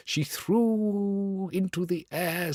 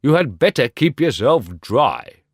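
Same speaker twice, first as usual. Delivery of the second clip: called out louder, sharp warning; cried out; 7 dB louder than usual. Acted. Roman candle calls out to the rocket, loud warning. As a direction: snap the advice across. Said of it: "cried the Roman candle".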